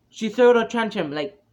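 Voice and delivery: normal talking voice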